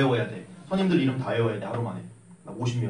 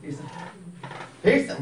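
a casual, tossed-off "필승!" said twice, lighter than the private's; sergeant's salute. Retold or imitated. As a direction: throw 필승! away like a bored sergeant. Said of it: "병장 때는 이렇게".

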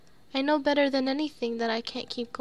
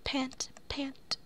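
whisper; 8 dB quieter than usual. Acted. Reading panting text.

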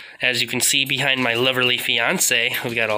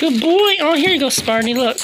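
puppy praise voice; 11 semitones higher than usual. Real, not acted. Owner cheering her dog Sparty in a high puppy voice. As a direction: squeak it up for the puppy.

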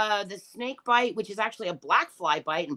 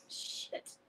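breathless whispered shit; a broken whisper under her breath; whispered curse; 19 dB quieter than usual. Real, not acted. Grieving [name], a whispered curse.

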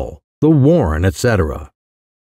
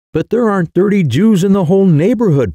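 raised, higher pitched protest; 8 semitones higher than usual. A narrator's reading. Huddle protests the massacre plan to Clovis, pitched up in disbelief. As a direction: object in disbelief, voice up. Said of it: "protested Huddle".

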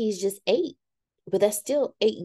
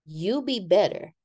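ordinary talk, nothing marked

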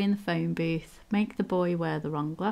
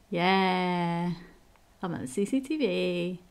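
ordinary talk, nothing marked

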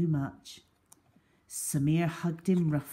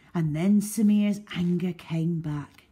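usual speaking voice

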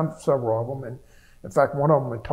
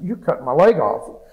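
agitated; 2 semitones higher, 7 dB louder than usual. Retold or imitated. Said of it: "And I'm, like, really agitated".

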